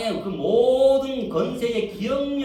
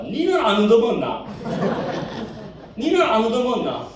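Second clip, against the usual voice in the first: loud, higher, heated jab; mock angry retort; 3 semitones higher, 6 dB louder than usual. Cornered grandma snaps back at her friend, loud. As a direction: fire it back at her accuser. Old woman counterattacks.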